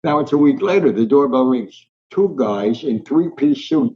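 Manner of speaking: normal talking voice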